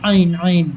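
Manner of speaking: আইন, আইন said carefully from the throat; throat letter demo. Shown as a demonstration. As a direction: pronounce আইন from the back of the throat, clearly. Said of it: "আইনটা ভালো করে উচ্চারণ হবে, গলার সেকেন্ড সাইডে আসবে".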